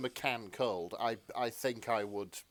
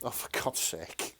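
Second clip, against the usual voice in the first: exasperated; 3 semitones lower than usual. Real, not acted. Exasperated at crude question.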